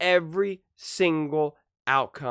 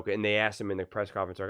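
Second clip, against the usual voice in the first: ordinary talk, nothing marked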